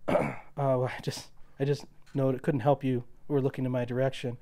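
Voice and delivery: softly hesitant, mildly nervous approach. Acted. George approaching a woman at the bar, mildly nervous. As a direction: stumble gently into a timid acted opener. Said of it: "nervous and says".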